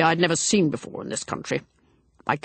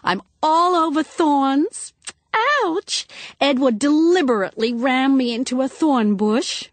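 put-on higher, slow complaining young woman; 7 semitones higher, 3 dB louder than usual. A narrator's reading. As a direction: whine about the thorns. Lucky complaining about thorns.

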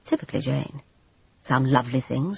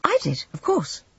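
quickly; 12 semitones higher than usual. Acted. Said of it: "Jane said very quickly".

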